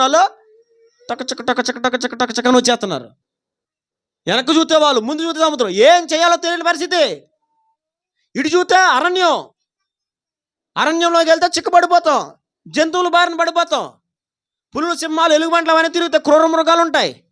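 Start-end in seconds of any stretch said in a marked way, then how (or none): 1.1-2.5 rapid, rhythmic chant of galloping; imitated chariot clatter. 4.3-17.2 high, urgent listing of dangers; acted alarm. Voices the trapped Israelites' alarm.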